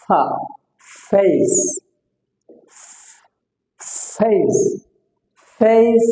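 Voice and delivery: long breath of f opening "ফা" and "ফেস"; drawn-out f sound. Shown as a demonstration. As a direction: hold the f before "ফেস", word by word. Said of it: "ফা sound দিয়ে বলছি". (ফ ধ্বনি টেনে "ফেস")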